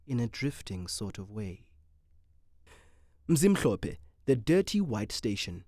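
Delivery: ordinary delivery, nothing marked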